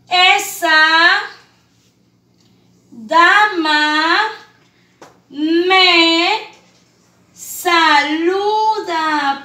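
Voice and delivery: long pauses between words, loud; spaced reading for children. Shown as a demonstration. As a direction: read "Esa dama me saluda" word by word. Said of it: "Qué dice aquí".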